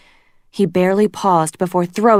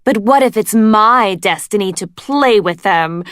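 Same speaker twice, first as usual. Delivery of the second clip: mocking queen; 3 semitones higher, 5 dB louder than usual. A narrator's reading.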